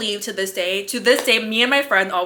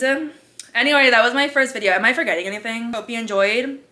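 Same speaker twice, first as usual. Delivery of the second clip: ordinary talk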